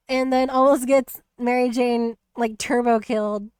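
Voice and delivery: usual speaking voice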